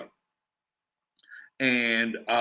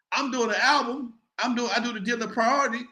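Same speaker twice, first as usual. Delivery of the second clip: ordinary delivery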